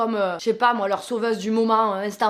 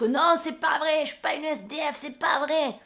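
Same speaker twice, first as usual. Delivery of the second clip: a hint of the woman's voice, higher; indignant denial; 5 semitones higher than usual. Woman denying to the neighbour she's homeless: higher, indignant. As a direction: protest it with offended pitch.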